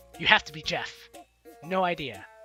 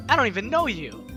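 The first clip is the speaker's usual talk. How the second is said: loud, higher, hammed-up outburst; mock indignant protest; 4 semitones higher, 7 dB louder than usual. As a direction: push it out as if affronted. Acting out a dialogue option.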